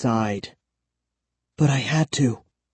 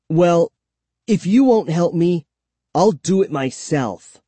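declaring; 5 semitones higher than usual. A narrator's reading. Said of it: "I declared".

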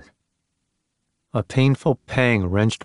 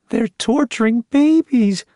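much higher, slow, horrified woman; distraught cry; 12 semitones higher, 3 dB louder, slower than usual. A narrator's reading. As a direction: wail it out slowly in horror. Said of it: "Terry cried, distraught".

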